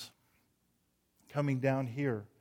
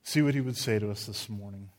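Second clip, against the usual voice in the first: normal talking voice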